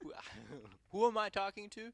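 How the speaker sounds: high, loud puzzled phone voice; confused caller voice. Retold or imitated. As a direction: play a baffled stranger on the phone. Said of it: "they'd be like".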